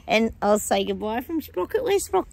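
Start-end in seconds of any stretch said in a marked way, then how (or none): 1.8-2.3 pet talk. Real, not acted. Calling her dog.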